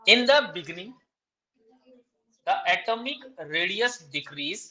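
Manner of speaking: slow, deliberate dictation, each phrase spaced out. Shown as a demonstration. Teacher dictating exam answer to students, slow dictation. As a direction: read out notes word by word for students to copy. Redictates after student missed part.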